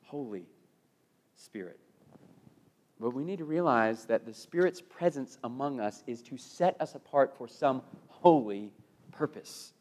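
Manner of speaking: normal talking voice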